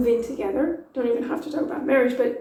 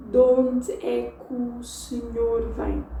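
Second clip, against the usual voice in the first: slower, words still joined; De onde é que o senhor vem slow but linked; slowed down version. Shown as a demonstration. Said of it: "slow it down and see if I don't say it like this".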